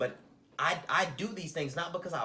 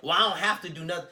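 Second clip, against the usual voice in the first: fast, louder, brushing the request off; dismissive retort; 4 semitones higher, 8 dB louder, faster than usual. Retold or imitated. Imitating co-parent brushing off his request, dismissive and fast. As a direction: snap it off like it's no concern of yours. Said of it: "she has this tendency to be like".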